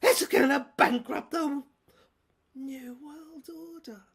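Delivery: high, loud, unhinged tycoon rant; a shrill, loud madman's tirade; ranting villain. Acted. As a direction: rave like a cornered mogul losing his grip. Raving villain monologue.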